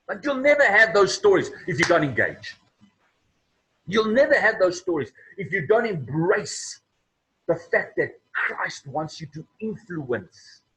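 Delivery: loud, high, emphatic preacher's charge; forceful preaching. Real, not acted. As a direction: drive it home to the congregation.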